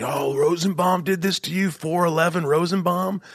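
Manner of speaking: high, teasing singsong jeer; hammed-up incredulous taunt; mocking. Mimics everybody making fun of enemy.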